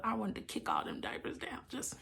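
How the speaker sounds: soft aside. Real, not acted. Confessing frustration under her breath.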